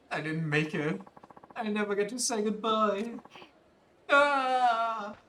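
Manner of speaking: put-on high boy's voice, slow and urgent on the chase; boy hero, frantic. Streamer voicing a boy who misses his goodbye, high and pleading. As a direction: play the kid desperate to catch the pelican.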